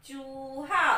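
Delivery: चूहा with a held long ऊ; long oo stressed. Shown as a demonstration. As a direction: draw out the ऊ in चूहा. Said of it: "वो थोड़ी लंबी आ रही है".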